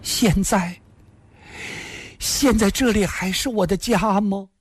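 high, sorrowful woman's voice; plaintive, hesitating after the first word. A narrator's reading. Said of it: "凄然地说道". (凄然地高声细问)

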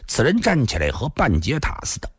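usual speaking voice

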